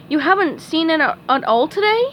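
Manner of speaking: lightly voiced Texan squirrel; a hint of a kind squirrel; Sandy voice. A narrator's reading. Sandy speaks.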